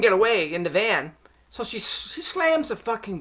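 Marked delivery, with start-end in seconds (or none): none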